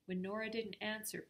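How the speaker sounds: ordinary delivery, nothing marked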